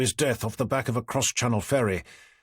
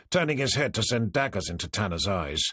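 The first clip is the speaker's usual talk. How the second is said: usual speaking voice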